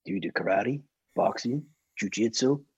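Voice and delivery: put-on low American accent, listing each word; Clint Eastwood voice. Clint Eastwood questioning the bodyguard, low American accent. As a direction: quiz him low and easy like an old cowboy star. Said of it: "in that accent".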